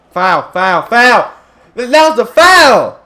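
real outrage at the referee, rising; foul protest. Real, not acted.

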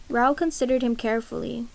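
ordinary delivery

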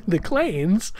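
ordinary delivery

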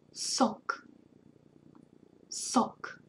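sok said with a hissed S, never a K; teacher modelling sok; S sound demo. Shown as a demonstration. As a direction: say sok with a clean S. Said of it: "always makes the S sound".

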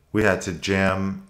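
ordinary talk

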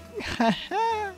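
sudden high burst of laughter. Real, not acted. Gamer laughing at teammate's quip, high laugh. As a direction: cackle out high.

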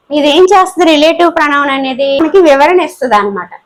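ordinary talk, nothing marked

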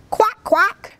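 high, squawky storybook duck; high-pitched quacking voice. A narrator's reading.

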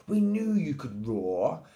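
a touch of parental pride; proud lion parents. A narrator's reading. Mummy and Daddy Lion to cub, mildly proud. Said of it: "Daddy and Mummy Lion said".

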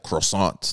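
croissant said the French way, "krwa-SAHN". Shown as a demonstration. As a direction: say croissant with a French accent, nasal end. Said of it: "like with the accent".

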